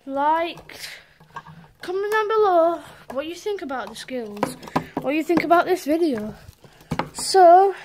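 loud, hyped YouTube sign-off; energetic outro. Acted. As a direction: shout the outro to your fans.

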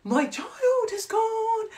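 theatrical lament that climbs very high and drags; mock wail. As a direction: overplay the heartbroken mother. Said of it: "I was like".

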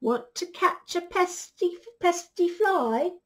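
high, loud storybook rooster; rooster's voice. Acted. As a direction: call out like a crowing rooster. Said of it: "crowed the rooster".

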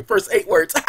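ordinary talk, nothing marked

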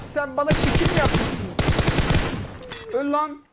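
loud, high mocking challenge; angry taunt. Real, not acted. Gamer taunting an enemy player, loud and angry. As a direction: call him out for showing off.

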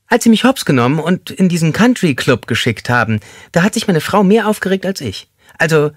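high, thin, squeaky man's voice; squeaky-voiced inmate joking with the group. A narrator's reading. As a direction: pipe it up high like a small squeaky man. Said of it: "mit seiner piepsigen Stimme".

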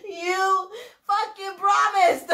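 high, drawn-out mock complaint; mock accusation. Repeating her accusers' complaint to viewers, drawn-out mock accusation. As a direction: stretch out the imitated accusation.

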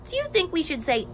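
ordinary delivery

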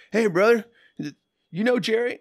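high, loud bro greeting. Retold or imitated. Singer greeting a stranger at a party, high and loud. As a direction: hail him like an old buddy, pitched high. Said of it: "he says".

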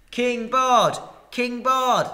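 loud high chant of acclaim; crowd shouting. Acted. The crowd hails Bard as king, shouting. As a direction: hail him as king, loud. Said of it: "they shouted".

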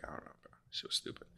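2 whispered, resigned; 3 breathe it out under the voice; 4 soft whispered dismissal. Real, not acted. Voice drops to whisper.

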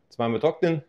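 ordinary delivery

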